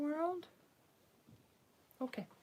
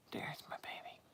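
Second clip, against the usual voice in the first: tender whisper; 9 dB quieter than usual. Real, not acted. Greeting her pet baby, tender whisper. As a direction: breathe it softly to him.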